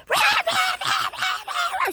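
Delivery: slow, very high mock shriek of rah; mock screaming. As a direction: imitate her yelling with a shrill, drawn-out rah. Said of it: "screaming at the top of her lungs".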